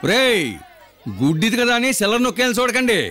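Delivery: threat. Acted. Beggar boss threatening the kids.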